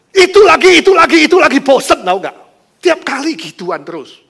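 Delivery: husband snapping at his wife over her faith; exasperated outburst. Acted. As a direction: throw it back at her, sick of hearing it.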